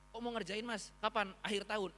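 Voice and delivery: excited, high-pitched quick questions; antusias girang. Retold or imitated. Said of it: "Mereka justru kayak seneng gitu". (bertanya girang dengan nada tinggi)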